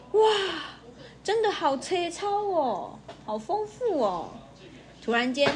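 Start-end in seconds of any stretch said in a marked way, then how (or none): 0.1-4.3 wide-eyed wonder. Acted. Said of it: "乡下老鼠瞪大眼睛说".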